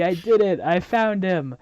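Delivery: high, loud mock cheer; happy triumph. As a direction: imitate a happy drunk celebrating. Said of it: "Crow's just, like, happy".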